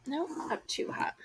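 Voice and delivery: quick hushed whisper. Real, not acted. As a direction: murmur it under your breath, voiceless. Aside while adjusting item.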